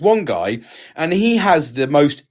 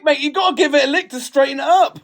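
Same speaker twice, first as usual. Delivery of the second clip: mock painter; 11 semitones higher than usual. Mock painter's advice, high voice.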